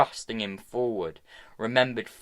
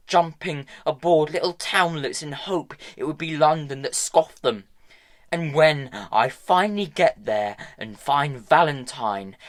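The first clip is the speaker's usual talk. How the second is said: higher, louder bitter tirade; angry rant; 5 semitones higher, 6 dB louder than usual. A narrator's reading. Hester raging at Tom about Valentine, angry rant. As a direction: vent her fury at him.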